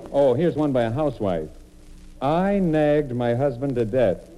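ordinary delivery

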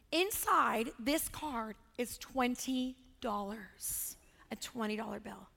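slow, low and soft for suspense; hushed reveal. Real, not acted. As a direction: let it sink to a near whisper. Dramatic reveal of the card's contents.

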